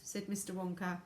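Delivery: ordinary delivery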